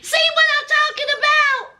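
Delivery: high-pitched, quick exclamation; excited. Real, not acted. Vlogger asks if y'all saw the house shoes, high and excited. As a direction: voice leaps up, egging viewers on.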